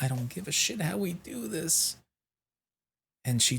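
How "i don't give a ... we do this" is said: much higher, softer strained mock cry; high, frazzled, done with it all; exasperated squeak. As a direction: squeak it out at the end of your rope. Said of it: "I'm like".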